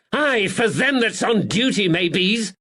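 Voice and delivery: high, loud scolding yell. Acted. Landlady yells at the policeman brother. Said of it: "she yelled".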